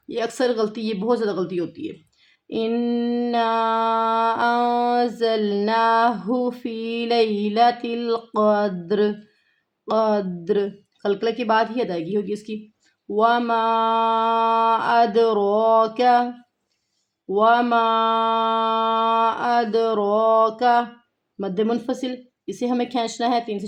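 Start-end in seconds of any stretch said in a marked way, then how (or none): 2.5-10.6 slow, drawn-out tajweed chanting; Quran recitation. Shown as a demonstration. Teacher chanting the verse slowly for her students. 13.1-20.6 slow, drawn-out tajweed chanting; Quran recitation. Shown as a demonstration. Teacher chanting the verse slowly for her students.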